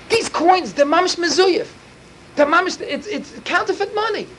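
high, outraged cry over the fake coins; indignant discovery. Judge exclaiming at the coins on the table, high and outraged. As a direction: sound scandalized, pitch shooting up.

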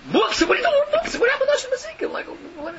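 high, incredulous mocking squeal; high mocking amazement; mock disbelief. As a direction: scoff at him in a high voice. Mocking a boy's study choice.

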